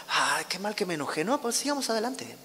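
hammed-up soft waking remorse; mock morning regret. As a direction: act regretful, then lightly brush it off. Acts someone waking after anger.

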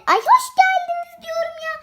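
high, loud, exasperated correction; fed up that nobody gets it right; high-pitched huffy insistence. Pretend.

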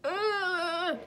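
high, pained mock groan; anguished ugh. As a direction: whine in mock grief. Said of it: "I was like".

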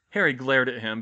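ordinary delivery, nothing marked

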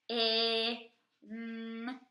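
F, I, N each held apart; teacher modelling segmenting; sounds segmented. Shown as a demonstration. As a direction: say fin one sound at a time. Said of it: "Say the sounds in the word fin".

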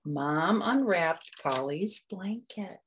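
usual speaking voice

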